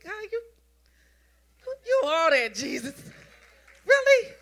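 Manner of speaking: high, loud thrilled cry to God; joyful shout of surprise; overjoyed disbelief. Retold or imitated. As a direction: burst out in delighted amazement. Retold amazement at the suite.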